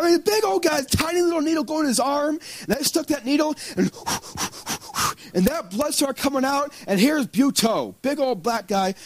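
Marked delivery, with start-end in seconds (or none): 3.9-5.1 quick, soft, voiceless puffs of breath; imitated panicked puffing. Big guy getting the needle, mimicked panicky puffing. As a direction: mimic huffing through a needle jab.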